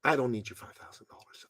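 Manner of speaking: soft whispered confidence; a mentor whispering a hard truth. Retold or imitated. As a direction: breathe it out, no voice at all. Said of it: "He was much more quiet than I was".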